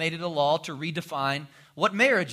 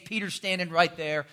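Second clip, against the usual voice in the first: ordinary delivery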